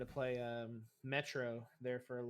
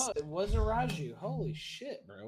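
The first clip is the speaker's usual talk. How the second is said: excited; 7 semitones higher, 7 dB louder than usual. Real, not acted. Reacting to ghost reveal.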